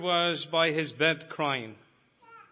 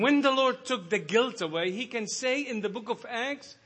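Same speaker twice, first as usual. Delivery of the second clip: full-force preaching; 6 semitones higher than usual. Acted. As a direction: preach it out with rising fire.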